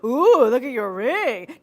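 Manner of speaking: loud, high, slow "Ooh, look at your ring"; a loud tacky gawk at a ring; hammed-up loud ooh, drawn out; mock loud gawk. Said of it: "You don't go".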